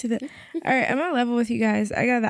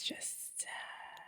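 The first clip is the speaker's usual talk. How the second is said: whispering; 23 dB quieter than usual. Real, not acted. Fading into whisper.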